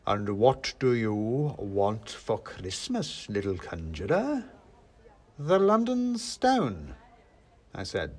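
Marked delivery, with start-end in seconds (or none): 0.1-4.4 slow, weighty questioning; big man toying with a small conjurer; Father Christmas. A narrator's reading. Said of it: "said Father Christmas". 5.4-6.9 slow, high, drawn-out answer; name the prize while holding back glee; barely contained triumph; suppressed triumph. A narrator's reading. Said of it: "trying hard not to sound triumphant".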